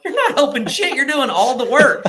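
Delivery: laughing outraged shout; exasperated yell. Retold or imitated. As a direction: shout it at your gym partner. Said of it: "I'm like".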